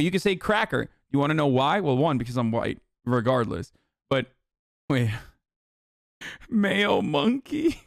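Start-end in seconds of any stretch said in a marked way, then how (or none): 6.5-7.8 slow, high disbelieving read; can't believe what he's reading, high and drawn out; incredulous. Real, not acted. Streamer reading a slur list, high and incredulous.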